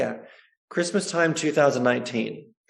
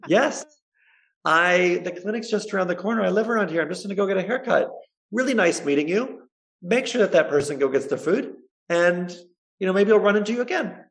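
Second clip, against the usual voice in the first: higher, quick, flustered politeness; flustered friendly reply; 7 semitones higher than usual. Retold or imitated. Answering a fan in the street, quick and flustered. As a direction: rattle it off, friendly but hurried. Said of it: "And I was like".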